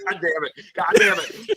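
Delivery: second curse jumps way up, cracking; hammed-up groan of teen annoyance; mock frustration. Kid cursing at a fake video, mock frustration.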